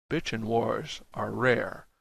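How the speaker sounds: ordinary delivery, nothing marked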